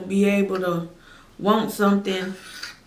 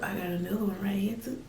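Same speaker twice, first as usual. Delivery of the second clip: choked up but still talking; tearing up; 9 dB quieter, faster than usual. Real, not acted. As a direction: voice catching, softer, a little fast. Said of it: "tearing up".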